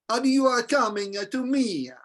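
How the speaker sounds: mock Italian, words joined with an added "ah"; Italian linking. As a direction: glue "are" and "coming" with an extra "ah". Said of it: "they join the word with the "ah".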